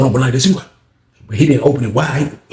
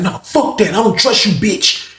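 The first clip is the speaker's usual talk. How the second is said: angry retort; 7 semitones higher than usual. Retold or imitated. Acts old head going in.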